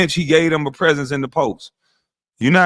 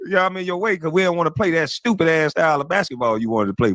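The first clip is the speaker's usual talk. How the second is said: ordinary delivery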